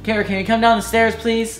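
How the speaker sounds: high, quick mom calling. Acted. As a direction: call her down like a mother. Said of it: "Sam's gonna be the mom".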